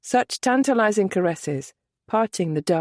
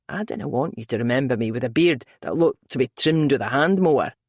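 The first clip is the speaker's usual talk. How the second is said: low Scottish brogue, male lead; deep, easy Scottish man; 5 semitones lower than usual. A narrator's reading. Male character voice.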